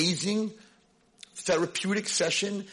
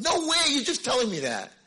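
put-on higher and louder, a teenage girl scoffing; doubting girl; 11 semitones higher, 4 dB louder than usual. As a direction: scoff like a kid who won't believe it.

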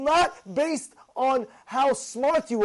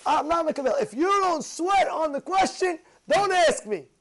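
rabbi's firm rule; 7 semitones higher, 3 dB louder than usual. Retold or imitated. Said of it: "And Rav Lamed said".

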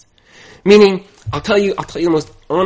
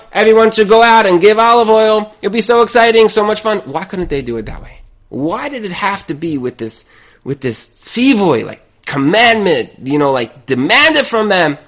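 ordinary talk

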